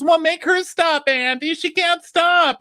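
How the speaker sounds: very high, loud falsetto whine; shrill complaint; whiny mock plea. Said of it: "She goes".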